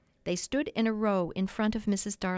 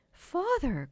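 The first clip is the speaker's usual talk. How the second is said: high shocked cry of Father; 8 semitones higher than usual. A narrator's reading. Daughter cries out, shocked at her father. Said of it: "cried Wendy, shocked".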